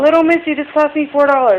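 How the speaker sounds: high, loud playful telling-off; mock scolding. Real, not acted. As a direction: scold the little one with a grin. Mother scolding toddler playfully.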